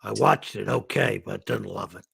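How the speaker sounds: lightly put-on Bronx accent; a hint of a New York critic. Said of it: "I just doing a New York voice".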